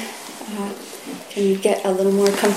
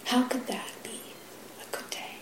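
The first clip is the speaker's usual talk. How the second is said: breathy whisper, fading almost to nothing; whispered question; 5 semitones higher, 13 dB quieter than usual. Real, not acted. Teacher asking the grieving koan question, in a soft whisper. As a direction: let the question dissolve into a whisper.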